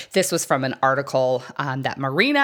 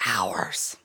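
whispered; 8 semitones lower, 6 dB quieter than usual. Real, not acted. Whispered emphasis.

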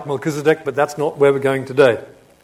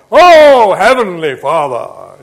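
showy loud prayer; 3 semitones higher, 11 dB louder, slower than usual. Acted. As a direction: pray for an audience, booming and drawn out. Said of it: "this wasn't a loud voice for the sake of being heard to pray".

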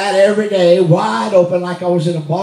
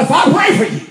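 bold proclamation; 10 semitones higher, faster than usual. Shown as a demonstration. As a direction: announce "pray" like a preacher claiming power. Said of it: "I said it like this".

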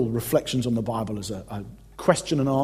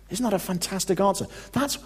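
normal talking voice

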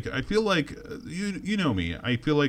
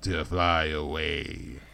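Boba Fett voice; 6 semitones lower, slower than usual. Retold or imitated. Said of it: "he's like".

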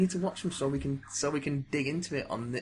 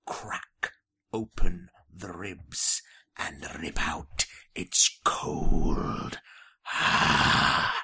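slow, low, menacing growl; sinister horror voice; 8 semitones lower, much slower than usual. Acted. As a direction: relish the gore like a horror villain. Gory autopsy description, slow and deep.

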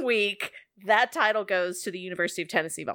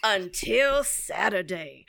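fairy-tale storytelling; 3 dB louder than usual. Pretend. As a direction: tell it like a bedtime story. Tells the football result as a fairy tale.

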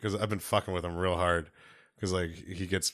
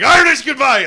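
much higher, loud shout right at them; shout in face; 13 dB louder than usual. Retold or imitated. Shouting Irish goodbye right in a bar patron's face. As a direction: yell it in someone's face. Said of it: "and you're just like".

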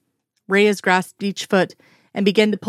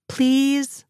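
high, coaxing little please; pleading please; 6 semitones higher than usual. Acted. Reyes begging Skinner to ride the slide with her, high and pleading. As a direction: beg him sweetly, drawn up high.

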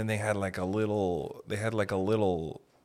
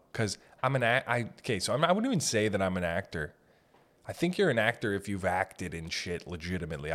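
ordinary delivery

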